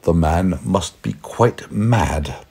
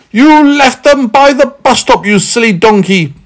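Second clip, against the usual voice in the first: high, loud exasperated scolding; impatient; 6 dB louder than usual. A narrator's reading. Man telling Meddle off, impatient. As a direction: snap at a fool, high and loud. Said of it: "said the man impatiently".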